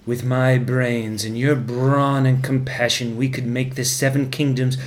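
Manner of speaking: a hint of a sly, slow dwarf pleading his love; slow, low-key scheming uncle; lightly voiced Tyrion. Tyrion begging Joffrey to choose him, lightly voiced and slow.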